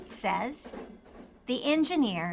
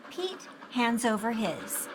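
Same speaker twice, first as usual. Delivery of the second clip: plain reading voice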